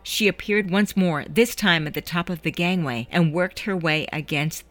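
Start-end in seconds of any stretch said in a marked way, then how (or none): none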